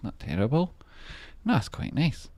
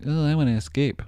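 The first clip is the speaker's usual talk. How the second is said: mock whiny plea; 8 semitones higher than usual. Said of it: "We wouldn't just be like".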